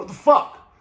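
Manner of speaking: high, loud startled cry of disbelief; shocked outburst. Retold or imitated. Caught off guard by a man in a bush, startled loud cry. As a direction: jump out of your skin at the stranger.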